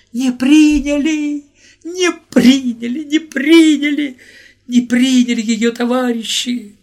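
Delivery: high, loud and drawn-out tearful yell; anguished shout. Acted. Volodya crying out to everyone on the platform, high despairing shout. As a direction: cry out to the comrades in despair. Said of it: "закричал он".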